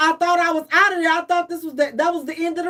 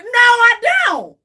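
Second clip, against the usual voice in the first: higher, louder shouted no; emphatic refusal; 7 semitones higher, 8 dB louder than usual. Real, not acted. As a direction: shut the offer down hard. Refusing the offer.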